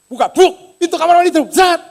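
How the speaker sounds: high, loud, punchy sound effects; dramatic re-enactment. Retold or imitated. Maid demonstrating the bathroom door opening, explosive. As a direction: burst out with each door noise. Acts out door bursting open.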